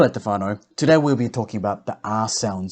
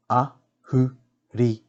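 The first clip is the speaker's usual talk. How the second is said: "Ahuriri" slowly split as A, hu, ri, ri; slow teaching breakdown of "Ahuriri"; broken into syllables; slower than usual. Shown as a demonstration. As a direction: sound out all four syllables separately. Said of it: "break down the words first".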